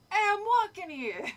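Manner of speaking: loud, high mock New York accent. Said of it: "or is that New York?".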